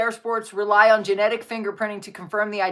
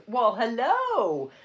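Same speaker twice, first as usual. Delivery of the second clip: soft pet greeting; 2 semitones higher, 4 dB quieter, much slower than usual. Real, not acted. Greeting and praising the dog.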